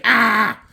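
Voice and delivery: sudden high, loud yelp; short yelp. Retold or imitated. The Thing getting crushed by the defenses, a high yelp. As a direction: cry out as you get crushed.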